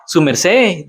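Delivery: put-on Su merced in Boyacá Colombian Spanish, falling on merced; Boyacá way of speaking. Shown as a demonstration. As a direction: say Su merced like a Boyacá local, landing on merced. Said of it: "That's how they talk".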